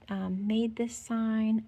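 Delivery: ordinary delivery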